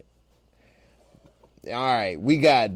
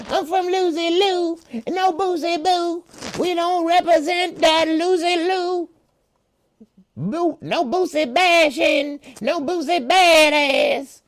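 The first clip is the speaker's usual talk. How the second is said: [name] impression; 13 semitones higher than usual. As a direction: go way up high like Boosie protesting. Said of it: "Don't I sound like him?".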